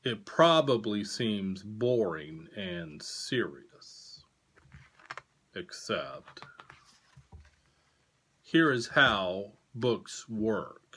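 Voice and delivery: slow, deliberate storybook read; reading to children. A narrator's reading. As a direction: read it to kids, slow and clear. Reading picture book aloud.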